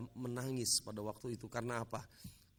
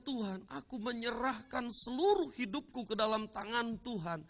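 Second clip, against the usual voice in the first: impassioned surrender prayer; 9 semitones higher, 3 dB louder than usual. Retold or imitated. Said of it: "ketika dia mengatakan".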